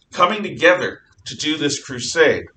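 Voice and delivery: ordinary talk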